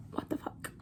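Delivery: breathy whispered disbelief. Real, not acted. Teen whispers at the absurd threat, hushed disbelief. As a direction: whisper it in shock.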